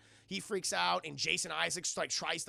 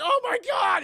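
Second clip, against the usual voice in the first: high, loud cry of shock; hammed-up appalled yell; horrified shout; 13 dB louder than usual. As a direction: exclaim in horror. Onlookers horrified, acted.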